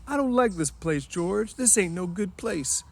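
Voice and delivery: a scared man begging to leave; Lenny crying out. Acted. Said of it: "Lenny cried out suddenly".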